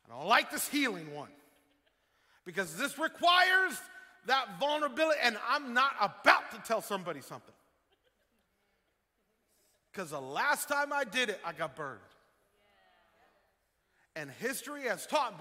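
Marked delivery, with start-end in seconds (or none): 0.1-12.0 loud, high-pitched mock refusal; mock balking. Preacher acts a churchgoer afraid of vulnerability, loud mock balking. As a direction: balk loudly as an unwilling churchgoer. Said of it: "some of you are just like".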